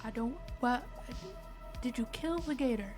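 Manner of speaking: usual speaking voice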